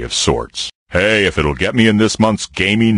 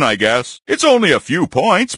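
ordinary talk

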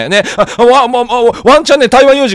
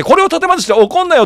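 teasing mock scolding; 8 semitones higher than usual. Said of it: "って言いながら".

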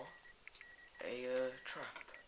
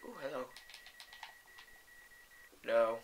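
ordinary talk

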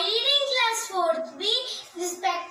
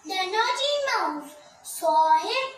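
ordinary delivery, nothing marked

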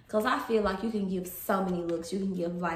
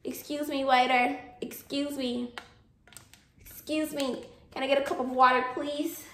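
polite diner; 5 semitones higher than usual. Pretend. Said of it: "I'm be like".

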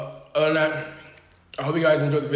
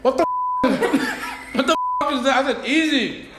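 shocked; 10 semitones higher than usual. Real, not acted. Burning mouth from spicy wings.

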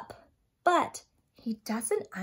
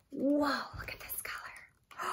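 hushed, breathy mock-fearful whisper; scared whisper; 11 dB quieter than usual. Reading the fear page to children, a scared whisper. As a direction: whisper it as if hiding from the dark.

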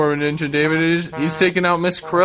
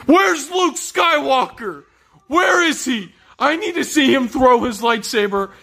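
demanding shout; 8 semitones higher than usual. Acted. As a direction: bellow it out impatiently. Yelling demand.

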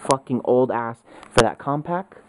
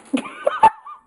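shrieking laugh, much higher; high burst of laughter; 5 dB louder than usual. Real, not acted. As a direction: crack up at the joke.

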